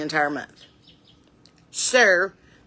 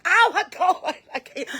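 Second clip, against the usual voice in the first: mock exasperation; 10 semitones higher than usual. Child cornered by both parents, mock overwhelmed. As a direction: give up in hammed-up despair.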